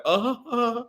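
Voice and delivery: high-pitched mimicked uh-huh; mimicked onlooker. Telling someone they'll just sit there looking, mimicking. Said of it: "sitting there looking like".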